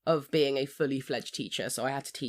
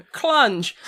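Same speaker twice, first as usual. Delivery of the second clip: loud, high shout with a laugh; imitated yell; 7 semitones higher, 8 dB louder than usual. Teacher imitating pupils yelling across the classroom, loud shout. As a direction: yell it out like a cheeky kid. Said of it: "to be yelling, like".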